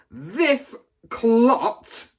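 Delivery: loud, high, contemptuous boom. A narrator's reading. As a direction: boom it across the hall. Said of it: "boomed the headmistress".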